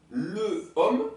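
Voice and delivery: ordinary talk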